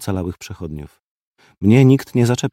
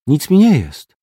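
unconvinced; 6 semitones higher, 5 dB louder than usual. A narrator's reading. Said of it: "odparł bez przekonania".

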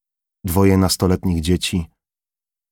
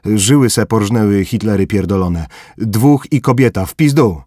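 loud, higher coarse soldier's yell; shouted report; 3 semitones higher, 5 dB louder than usual. Acted. Bykow shouting through window to corporal, coarse loud report.

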